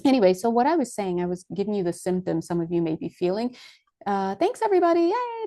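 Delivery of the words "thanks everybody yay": high cheerful yay; excited. Real, not acted. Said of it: "cheering spirit team".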